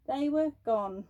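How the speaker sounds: ordinary delivery, nothing marked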